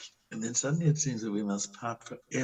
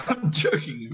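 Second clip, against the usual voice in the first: laughing; 5 semitones higher than usual. Acted. Astrov softens his accusation.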